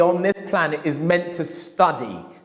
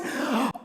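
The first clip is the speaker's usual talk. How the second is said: tearful gasp; 8 semitones higher, 8 dB quieter than usual. Retold or imitated. Customer near tears.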